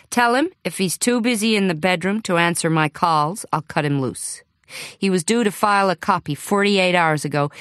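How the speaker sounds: a hint of an American editor on the phone; lightly American accent. Editor Rex phoning, mildly American accent. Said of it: "The caller had an American accent".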